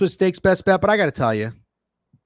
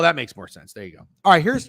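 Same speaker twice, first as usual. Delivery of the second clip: ordinary talk, nothing marked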